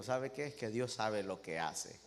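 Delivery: a quiet, humble servant answers his king gently; humble servant, hushed. Acted. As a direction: speak softly, like a meek servant. (siervo humilde, voz baja y serena)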